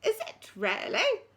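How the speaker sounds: put-on high, quavering old lady asks; old headmistress inquiring. Old head surprised.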